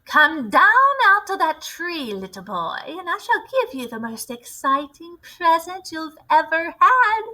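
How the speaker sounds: a metallic rasp, as if full of pins; rasping witch voice. Acted. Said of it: "Her voice had a curious rasping quality".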